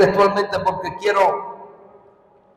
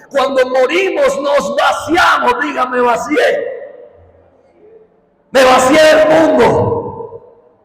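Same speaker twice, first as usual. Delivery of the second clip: raised, higher-pitched declamation; forceful preaching; 6 semitones higher, 4 dB louder than usual. Real, not acted. Preacher leading congregation to declare they emptied themselves, forceful preaching. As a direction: lead the crowd in emphatic declarations.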